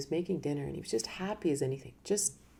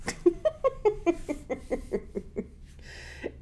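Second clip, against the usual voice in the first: light laugh; 12 semitones higher than usual. Real, not acted. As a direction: let a quick amused laugh slip out. Laughs after the story.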